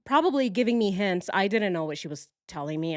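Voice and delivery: ordinary talk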